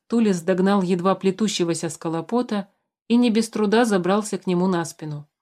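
usual speaking voice

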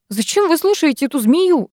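high, indignant protest; losing patience. A narrator's reading. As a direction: protest in exasperation. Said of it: "не выдержала я".

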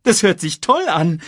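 high, loud eager hyena; Banzai. A narrator's reading. Hyena welcoming the boss's offer, high and eager. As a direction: jump at the offer. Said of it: "sagte Banzai".

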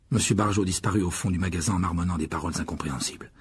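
usual speaking voice

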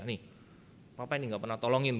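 normal talking voice

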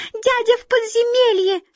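very high tiny mouse voice; high-pitched squeaky little creature; mouse squeak. A narrator's reading. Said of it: "подхватили остальные мыши".

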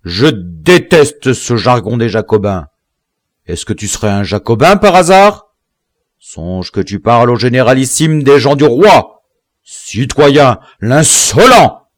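outraged shouting that builds to the last word; angry outburst. A narrator's reading. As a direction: berate the insolent prisoner. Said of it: "s'écria Biassou avec colère".

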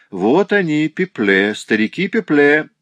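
high, loud self-announcement; exuberant greeting. A narrator's reading. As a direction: present yourselves with hearty excitement. Old friends present themselves exuberantly.

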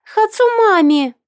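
put-on very high, drawn-out toddler whine; shrill child wail. A narrator's reading. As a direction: wail for mommy like a tiny girl. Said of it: "пронзительным голосочком". (пронзительный детский плач)

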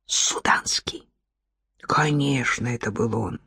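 near-whisper, dropping low; soft, low inner recognition; hushed realisation. A narrator's reading. Heroine's hushed realisation of rescuer.

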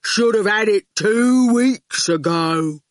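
slow thuggish goon; lumbering, unhurried menace. A narrator's reading. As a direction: dim enforcer taking his time. Goon character speaking.

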